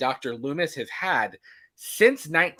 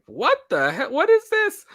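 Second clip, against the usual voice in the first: squeaky mock outrage; 9 semitones higher than usual. Cod voiced, high squeak.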